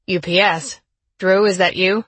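loud call down a hallway. A narrator's reading. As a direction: call out from afar. Delivery guy calling out.

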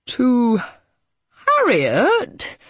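hesitant, halting lawyer voice; a touch of awkward solicitor. A narrator's reading. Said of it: "he said, a little awkwardly".

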